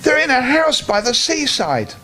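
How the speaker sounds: the bear spots his family; a mild glad cry, high; excited cry. Acted. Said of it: "cried Ernest".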